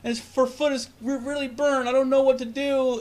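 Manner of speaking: high-pitched frantic plea, imitated; panicked mother. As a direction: play a stranded mom begging for help. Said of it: "a crying fucking baby ... And she's like".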